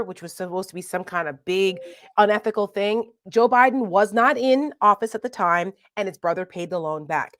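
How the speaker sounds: ordinary talk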